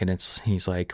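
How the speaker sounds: ordinary talk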